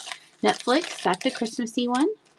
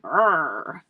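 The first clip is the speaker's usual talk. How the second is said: koala growl; 3 semitones lower than usual. Shown as a demonstration. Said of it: "Is it like".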